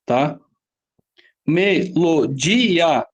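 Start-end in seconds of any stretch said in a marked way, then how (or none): 1.4-3.1 "Melodia" very slowly split as Me - lo - di - a; slow, separated syllables in "Melodia". Shown as a demonstration. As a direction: count out "Melodia" in four chunks. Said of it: "Quatro sílabas".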